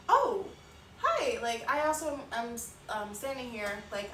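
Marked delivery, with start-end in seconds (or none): 0.1-1.4 high, loud startled oh hi; fake-bright hello to strangers; awkward surprised hi. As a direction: squeak out a caught-off-guard greeting. Awkward greeting to arriving people.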